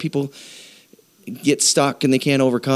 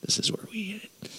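hushed, breathy aside; whisper; 10 semitones higher, 14 dB quieter than usual. Real, not acted. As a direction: drop to a whisper to draw them in. Aside before the scripture.

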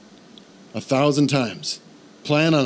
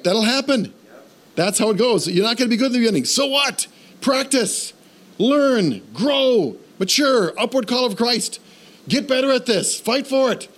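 high, driving exhortation; rally the congregation to fight for it; full-force preaching; 9 semitones higher than usual. Acted. Pastor urging the congregation to grow, preaching high and driving.

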